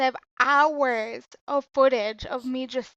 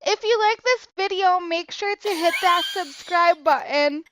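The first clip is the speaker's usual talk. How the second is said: higher vlogger outro; mimicking a child vlogger, higher; YouTuber voice; 5 semitones higher, 4 dB louder than usual. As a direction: pitch the subscribe button to fans. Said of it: "and she's like".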